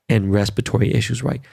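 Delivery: ordinary talk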